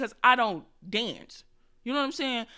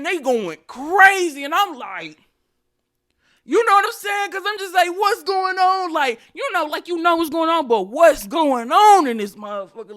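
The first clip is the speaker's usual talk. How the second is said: loud and high, amazed; excited; 6 semitones higher, 10 dB louder than usual. Real, not acted. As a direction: shout it over the noise of the church. Church going wild around her.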